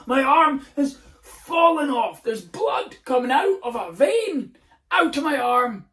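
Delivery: over-the-top fake emergency; mock panic. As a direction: wail like your arm fell off. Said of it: "go mental".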